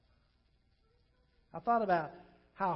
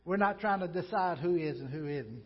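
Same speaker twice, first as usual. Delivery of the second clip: ordinary talk, nothing marked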